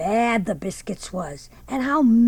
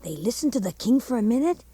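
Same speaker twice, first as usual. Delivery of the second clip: usual speaking voice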